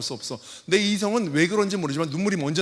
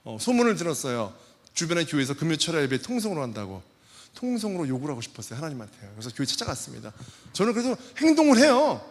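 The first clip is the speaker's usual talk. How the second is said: ordinary delivery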